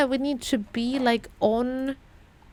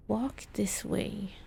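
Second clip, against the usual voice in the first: whisper; 3 semitones lower, 10 dB quieter, slower than usual. Pretend. Reading a clue aloud, whispered.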